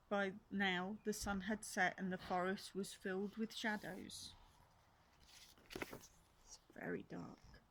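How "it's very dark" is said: slow, hushed and eerie; a slow, hushed warning; spooky whisper. Acted. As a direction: whisper it like a secret in the dark.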